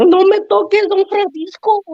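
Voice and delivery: high falsetto pleading; high mock woman's voice; mock girl's voice.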